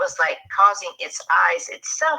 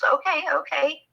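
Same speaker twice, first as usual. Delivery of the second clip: placating mom; 11 semitones higher than usual. Retold or imitated. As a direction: imitate a mother soothing a panicked child. Mom giving in to frightened child.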